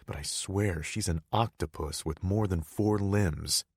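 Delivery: ordinary talk, nothing marked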